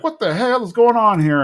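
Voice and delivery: high-pitched mock outrage; indignant question at normal volume; upset Buck. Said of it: "Buck is upset".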